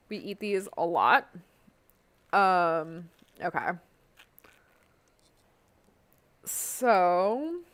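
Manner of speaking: talking through a mouthful of orange; words around a bite of fruit; chewing while talking. Real, not acted. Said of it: "Sorry for the eating sounds".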